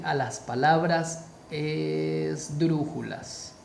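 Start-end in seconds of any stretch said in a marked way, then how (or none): 1.5-3.5 term drawn out very slowly. Shown as a demonstration. Teacher introducing the video's topic, drawn out. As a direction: stretch the word esdrújulas syllable by syllable.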